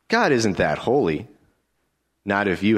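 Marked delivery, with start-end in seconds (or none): none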